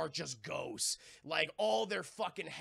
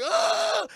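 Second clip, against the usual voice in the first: very high, loud drawn-out scream; all-out acted howl of horror; freak-out scream; 15 dB louder than usual. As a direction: scream as the memory floods back. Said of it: "he's fucking freaking out".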